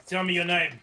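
interrogation bark. Acted. Officer grilling the caged prisoner, loud and demanding. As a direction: lean in hard and demand the name.

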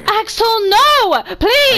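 high, loud, drawn-out wail; desperate pleading. Real, not acted. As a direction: cry out, begging them to stop. Begging Axel to stop.

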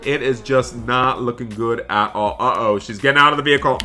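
ordinary delivery

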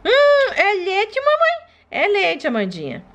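put-on high, slow humming baby; a toddler slurping her milk; baby sucking the bottle. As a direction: hum happily while drinking milk. Baby feeding sounds.

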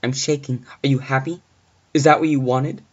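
high, loud, agitated demands; frightened outburst. Acted. Babysitter asking the caller if he is happy, frightened and angry. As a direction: build to a loud, upset challenge.

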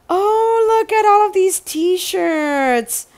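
sharp high gasp, then a cry of delight; excited gasp. Real, not acted. Showing viewers the t-shirts, high excited gasp. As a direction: gasp like finding treasure.

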